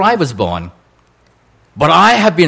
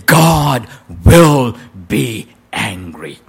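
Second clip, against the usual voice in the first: a hint of a grave mother, very slow; slow mock-ominous mother's warning; slow stern mother; 6 semitones lower, much slower than usual. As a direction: lean in with a slow solemn warning. Mother warns disobedient boy.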